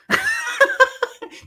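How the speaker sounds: bright burst of laughter; high real laugh. Real, not acted. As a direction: laugh out at the memory. Laughs at own story.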